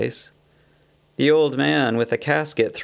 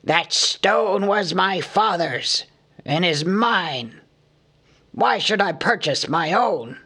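thick with wrath; 5 semitones higher than usual. A narrator's reading. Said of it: "his voice was thick with wrath".

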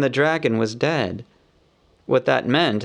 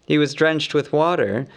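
usual speaking voice

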